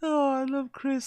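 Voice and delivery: high, slow and amused; high amused drawl. Real, not acted. Amused reaction to clip.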